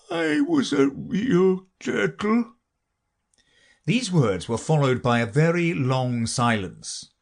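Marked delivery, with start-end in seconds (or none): 0.1-2.5 very high, slow sighing wail; Mock Turtle. A narrator's reading. Mock Turtle mourns what he once was, slow sighing lament. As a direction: lament it slowly in a high creature voice. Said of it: "said the Mock Turtle at last with a deep sigh".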